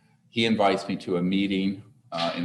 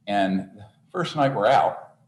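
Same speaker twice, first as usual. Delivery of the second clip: usual speaking voice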